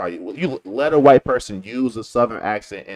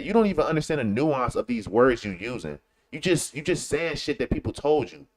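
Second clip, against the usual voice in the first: ordinary talk, nothing marked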